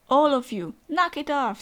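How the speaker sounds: loud scolding order, rising in pitch; acted shout. Character ordering everyone to stop, loud acted shout. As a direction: shout at the whole group to stop.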